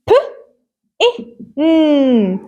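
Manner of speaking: "ب-، إيه، ن" as separate p, short i, held n; sounding out. Shown as a demonstration. Teacher spells out pin for pupils, sounding it out. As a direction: split "ب-، إيه، ن" sound by sound, slowly. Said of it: "Let's try to read this word".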